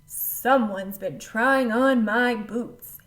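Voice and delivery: loud, slow papa bear boom; booming big voice. A narrator's reading. Papa bear finds his boots, booming. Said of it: "he boomed in his big voice".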